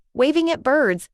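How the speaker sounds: plain reading voice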